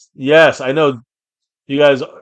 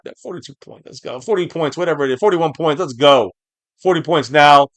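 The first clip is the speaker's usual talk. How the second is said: ordinary talk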